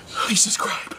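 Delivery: exhausted whisper. Real, not acted. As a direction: whisper it, wiped out. Whispered outro after ordeal.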